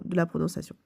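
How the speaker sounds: ordinary talk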